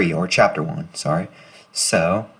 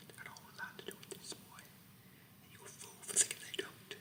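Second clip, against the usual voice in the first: menacing drill sergeant in a soft whisper; creepy whispering sergeant; 11 semitones lower, 10 dB quieter than usual. Acted.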